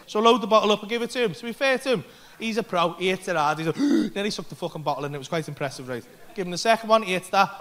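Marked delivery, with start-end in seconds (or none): none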